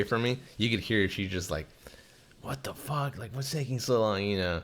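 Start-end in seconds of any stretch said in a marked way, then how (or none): 2.4-4.2 higher, annoyed mock lady voice; impatient lady. Lady waiting in line, mock annoyed voice. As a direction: pitch it up like an irritated shopper.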